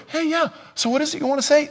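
high-pitched, hurried question; eager mock listener. New listener finally hearing God, eager high-pitched reply. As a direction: rush it out in a comically high voice.

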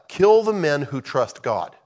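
loud, slow acted demand; mob outcry. Congregation demanding the faithful be stoned, angry outcry. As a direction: call for blood like an angry crowd.

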